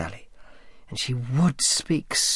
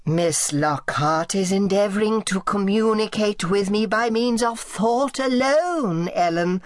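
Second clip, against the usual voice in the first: whining, creaking aunt; 11 semitones higher, 4 dB louder than usual. Acted. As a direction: creak it out like a frail old tyrant. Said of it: "Her voice whined and creaked".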